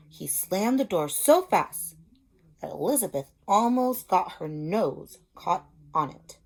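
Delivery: put-on reading a picture book to young children; storybook reading. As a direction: tell it like a teacher at story time. Story narration resumes.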